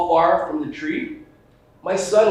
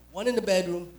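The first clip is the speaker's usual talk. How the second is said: usual speaking voice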